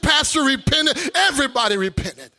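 loud, ringing revival preaching; loud joyful preacher; preaching at full force. Acted. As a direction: preach it out, building joy.

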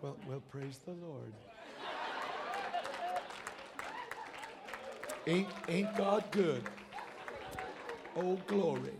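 very soft, low deadpan praise; mock underwhelmed. Preacher mimicking a muted lottery winner, mock underwhelmed. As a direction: feebly imitate someone barely moved.

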